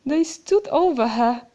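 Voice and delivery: ordinary delivery, nothing marked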